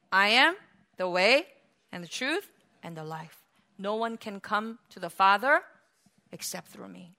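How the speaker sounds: low, soft, gently paused reassurance; kind, patient. Acted. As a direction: explain it tenderly and patiently, sinking to near-quiet. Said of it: "so kind and so patiently and say".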